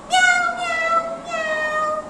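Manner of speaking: very high, soft kitten mews; baby cat meow. Shown as a demonstration. Teacher meows as the baby cat. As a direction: mew like a tiny kitten. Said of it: "cat sound for baby cat".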